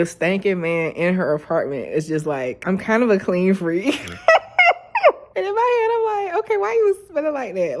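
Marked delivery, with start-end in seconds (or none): 4.1-5.3 high, loud real laugh. Real, not acted. Laughs at stinky man. 5.4-7.8 excited disbelief. Real, not acted. Laughing disbelief.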